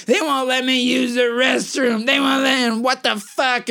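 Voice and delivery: high, slow shrieking crazy lady; mock scream. Man imitating a crazy lady screaming at his girlfriend. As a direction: shriek it into her face. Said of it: "starts screaming at her".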